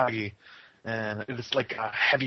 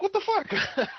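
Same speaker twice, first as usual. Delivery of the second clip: much higher incredulous what the fuck; mock bewildered viewer, pitched way up; exasperated reaction; slower than usual. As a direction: react in disbelief. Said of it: "You're just like".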